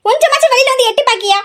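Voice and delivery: high-pitched nagging call to the sleeping daughter; scolding call. Acted. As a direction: call her out of bed, scolding. Mother calling daughter awake. (உரத்த அதட்டல் குரல்)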